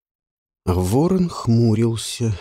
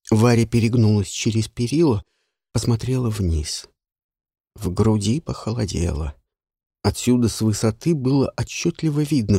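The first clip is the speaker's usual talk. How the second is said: plain reading voice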